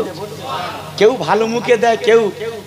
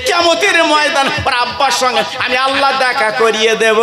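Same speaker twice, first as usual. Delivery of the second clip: preached divine promise; 5 semitones higher than usual. Acted. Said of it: "আমার আল্লাহ পাক দেখবে".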